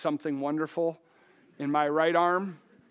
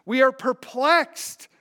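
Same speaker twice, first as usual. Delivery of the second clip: loud, measured preacher's reading; preaching Second Corinthians to the congregation; scripture reading; 5 semitones higher, 4 dB louder than usual. Acted. Reads scripture with preaching force.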